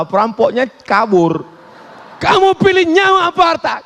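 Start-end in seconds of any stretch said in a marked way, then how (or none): 2.2-3.7 fast, high-pitched robber's demand; perampok mengancam. Acted. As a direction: rush out a shrieked mock threat. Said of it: "Kalau ada perampok bertanya begitu". (gertakan perampok cepat dan melengking)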